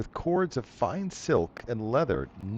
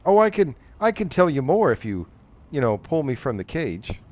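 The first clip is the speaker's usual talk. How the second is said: higher, louder wheedling bargain; sly crocodile man; 3 semitones higher, 7 dB louder, faster than usual. Acted. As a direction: coax them into freeing him.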